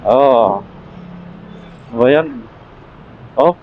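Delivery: ordinary talk, nothing marked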